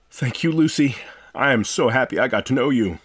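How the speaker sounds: ordinary delivery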